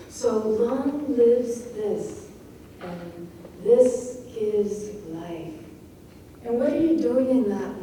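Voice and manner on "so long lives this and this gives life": slow stressed words, LONG LIVES THIS and GIVES LIFE; lots of emphasis. Shown as a demonstration. Teacher showing stresses in a row, slow and emphatic. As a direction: weigh "long", "lives", "this" one at a time. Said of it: "emphasis, lots of emphasis".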